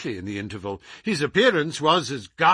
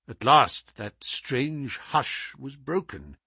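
usual speaking voice

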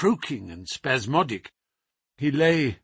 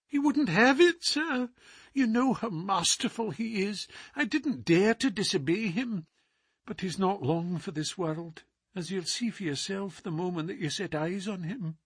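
higher, worried housekeeper; 6 semitones higher, 5 dB quieter than usual. Acted. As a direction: voice her as an anxious housekeeper. Housekeeper voiced in a story.

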